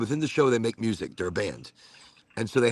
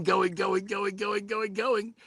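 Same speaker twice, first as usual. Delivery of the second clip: each going higher and thinner; rising strained voice; 8 semitones higher, 4 dB quieter, slower than usual. Retold or imitated. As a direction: pitch climbs like a dying engine. Car running out of gas.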